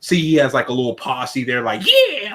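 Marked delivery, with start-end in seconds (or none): 1.8-2.3 high yelped hype-man yeah; hype man cheer. Retold or imitated. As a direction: cheer him on like a sidekick. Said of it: "like, hyping him".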